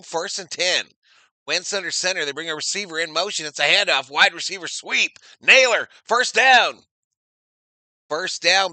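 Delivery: animated football call, rising to a loud, high first down; live play-by-play. Real, not acted. Commentator calling Naylor's sweep to viewers, excited play-by-play. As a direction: call the play as it breaks open.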